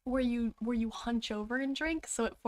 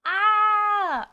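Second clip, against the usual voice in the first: excited squeal; 9 semitones higher, 9 dB louder than usual. Real, not acted. Squeal at the trailer.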